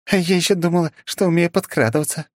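lightly voiced wry woman; Selma's voice. A narrator's reading. Selma speaks, dialogue.

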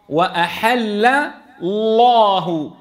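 slow "وأحل الله" broken in two, thin "وأحل", thick "الله"; light lam, heavy lam. Shown as a demonstration. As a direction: pause after a light "وأحل", then stretch a heavy "الله". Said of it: "ليش عم نفخم الثاني؟".

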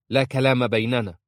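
loud, hard dismissal; angry refusal. A narrator's reading. Azza refusing to hear Aisha, loudly and angrily. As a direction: cut off all talk forcefully.

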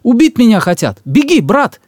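high, loud urgent cry; urgent shout. Acted. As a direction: yell for him to run. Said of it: "крикнул ему Эмархан".

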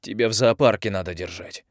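ordinary delivery

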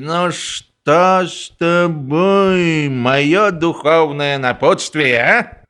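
put-on slow drunken slur; a drunk general, jovial. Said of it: "пьяным голосом спросил".

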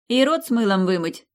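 loud high barked order; barked shout. Acted. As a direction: yell it after him. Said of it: "гаркнула я следом".

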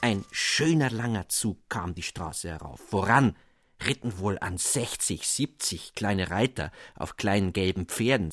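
plain reading voice

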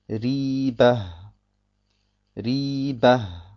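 Riba mit hellem R und gedehntem i; helles Ra. Shown as a demonstration. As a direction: sprich das R in Riba hell. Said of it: "dann wird es hell ausgesprochen". (Riba langsam, mit hell gefärbtem Ra)

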